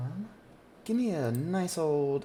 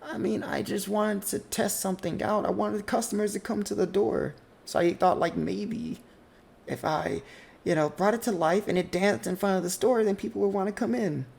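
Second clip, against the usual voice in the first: put-on high, defensive boy's voice; boy making excuses; 7 semitones higher than usual. Younger brother explains the dancing pot to his sister. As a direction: talk your way out of trouble.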